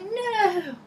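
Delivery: very high, drawn-out mock wail; wailed protest. Driver despairing at another turn, wailed protest. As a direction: whine it out in comic despair.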